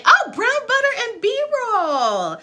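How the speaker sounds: high, drawn-out cry of delight; delighted exclamation. Real, not acted. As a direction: gush over it, stretching the words. Delighted reaction to a name.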